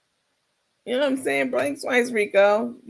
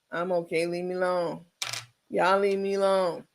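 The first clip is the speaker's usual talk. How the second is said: softer, flat, lifeless; zero emotion; 3 semitones lower, 4 dB quieter than usual. Retold or imitated. Rico assuring the camera he's fine, softer and flat. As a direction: say it like a hostage reading a card. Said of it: "Now that's what it was".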